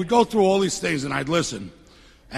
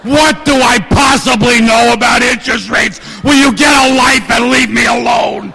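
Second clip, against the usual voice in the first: shouted rant, louder and higher; pretend outburst; 6 semitones higher, 7 dB louder than usual. Imagined telling his partner off over the phone, shouting. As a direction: blow up at the partner, yelling. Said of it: "And I wanted to say".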